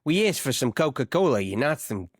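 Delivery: put-on sending reggaetoni for gabagool, Jersey Italian accent; New Jersey Italian accent. Jersey voice complaining about order.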